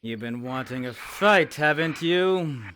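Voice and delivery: a loud, goading challenge; loud taunt. Acted. Fighter loudly goading an enemy into a fight. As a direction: raise the voice to goad him into fighting. Taunting a foe before battle.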